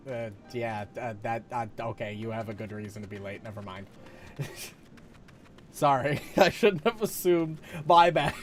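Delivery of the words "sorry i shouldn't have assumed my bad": laughing through the apology; embarrassed laugh. Real, not acted. Streamer laughing after misjudging the NPC, real laughter. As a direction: laugh it off, sheepish.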